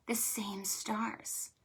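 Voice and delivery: slow hushed whisper of wonder. Shown as a demonstration. Librarian whispering to children about the sky. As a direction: breathe it out in awe. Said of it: "whispered wonder".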